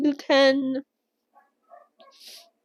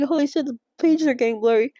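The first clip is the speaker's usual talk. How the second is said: usual speaking voice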